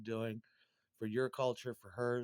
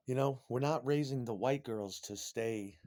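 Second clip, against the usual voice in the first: ordinary delivery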